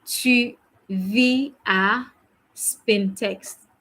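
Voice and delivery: Tu vis à, drawn out very slowly; very slow model. Shown as a demonstration. Teacher modelling the answer for students, very slowly. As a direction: stretch each word for the learners. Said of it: "You say".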